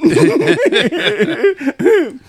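loud high-pitched laugh; high cackle. Real, not acted. Teller laughing at his story, loud high cackle.

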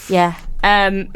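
normal talking voice

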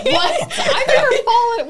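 high laughing disbelief; surprised laughing. Real, not acted. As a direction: squeal it out in amused shock. Disbelief at friend's falls.

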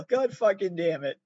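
ordinary talk

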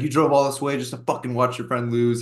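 retold taunt with some push; mild mock scream. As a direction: jeer like an angry fan, lightly acted. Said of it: "screaming at us".

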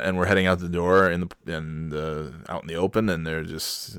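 ordinary delivery

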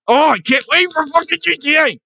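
high-pitched gleeful outburst; excited squeal. Real, not acted. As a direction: squeal it out, bursting with anticipation. Gushing about upcoming game.

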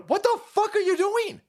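high-pitched imitated disbelief; retold incredulous outburst. Voicing onlookers watching the driver, incredulous outburst. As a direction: exclaim it as an appalled onlooker. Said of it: "People are sitting there going".

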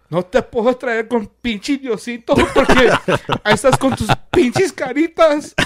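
high, loud mock rant in Spanish; hammed-up motherly tirade; upset mother. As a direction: imitate mom's exasperated scolding, half laughing. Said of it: "She was so up-, upset".

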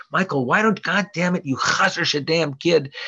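high, loud scolding grandmother; grandmother yelling. Retold or imitated. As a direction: shriek at the kid like an angry grandma. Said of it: "screamed and yelled at me a lot".

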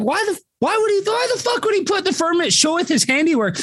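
high-pitched baffled questioning; incredulous. Retold or imitated. Speaker reacting to a puzzling tombstone inscription, incredulous. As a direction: demand an explanation, voice shooting up high.

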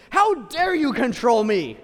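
hammed-up rebellion; mock defiance. Believer defying the church, loud mock outrage.

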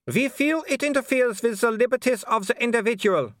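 high, polite baroness voice with a thin smile; a smiling aristocrat who means none of it; cool hostess smile. A narrator's reading. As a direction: welcome him coolly through a fixed smile.

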